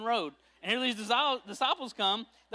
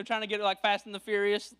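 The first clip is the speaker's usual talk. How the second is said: usual speaking voice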